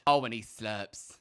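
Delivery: normal talking voice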